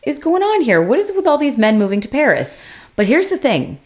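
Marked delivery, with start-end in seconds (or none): 0.0-2.6 much higher, fast, incredulous rant; a viewer fed up with yet another man moving to Paris, in high disbelief; exasperated disbelief. Retold or imitated. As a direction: throw up your hands at the screen.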